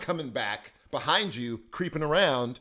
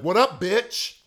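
playful greeting; 6 semitones higher, 8 dB louder, slower than usual. Retold or imitated. Acted greeting.

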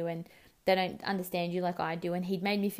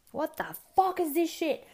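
high-pitched mock yell of outrage; imitated yelling; 10 semitones higher, 4 dB louder than usual. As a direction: imitate a man yelling out from the shower. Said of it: "like, yelling out from the shower".

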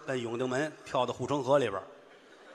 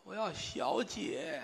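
put-on feeble dying old man; 5 semitones higher, 9 dB quieter, much slower than usual. Dying old man makes request.

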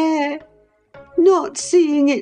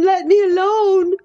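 high voice breaking into a sob; sobbing; 14 semitones higher than usual. Acted. Catherine sobs at Heathcliff to let her alone. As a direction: cry out through tears. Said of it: "sobbed Catherine".

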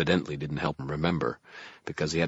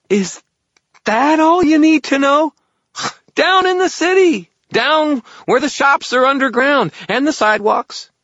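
very high, loud fairy squeal; exasperated fairy; 13 dB louder than usual. A narrator's reading. Fairy tears his hair at wizard's question, exasperated squeal. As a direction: wail it out at the top of the range. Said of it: "Toot tore at his lavender hair".

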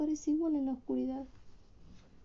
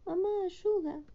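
desperate cry; 7 semitones higher than usual. Acted. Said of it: "Hilda está desesperada".

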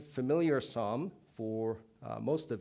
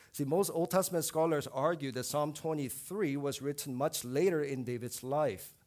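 normal talking voice

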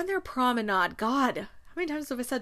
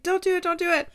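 high, loud mock plea; frantic, playful pleading; panicked pleading; 9 semitones higher, 6 dB louder, faster than usual. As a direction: beg the dog to stop, hammed up. Pleading with the dog.

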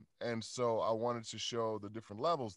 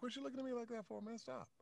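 high, soft, half-laughing hush; hushed aside; 12 semitones higher, 12 dB quieter, faster than usual. Retold or imitated. Father hushing his son in the audience, soft and high. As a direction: tell the kid to quit staring, under your breath.

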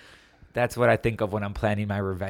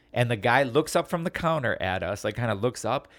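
usual speaking voice

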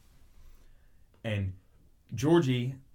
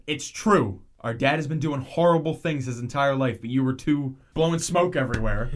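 loud, higher angry outburst at the brother; retold scream; 3 semitones higher, 6 dB louder than usual. Son screaming at his brother about their dad. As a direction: yell the truth out at him. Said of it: "he just screams".

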